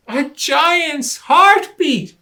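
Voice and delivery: high, slow, loud scared gasp of words; frightened cry. Acted. Rabbit tells Lion the ticking is a giant's heartbeat, high scared cry. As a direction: sound spooked out of your wits.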